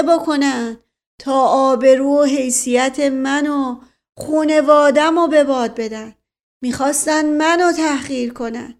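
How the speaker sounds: mounting, wounded tirade. A narrator's reading. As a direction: let the outrage swell as you go.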